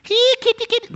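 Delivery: very high kitty call; coaxing cat call. Acted. Preacher calling kitty who won't come, high coaxing. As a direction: call the cat in a tiny squeaky voice.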